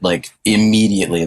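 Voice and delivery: ordinary talk, nothing marked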